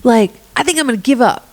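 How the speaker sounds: high, whiny self-doubt; mock defeated whine; doubtful whine. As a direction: whine it like you're ready to quit. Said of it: "you're like".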